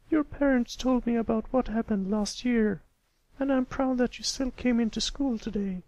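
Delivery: high patient teacher voice. A narrator's reading. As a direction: speak as a kindly schoolteacher to a pupil. Miss Shirley voiced.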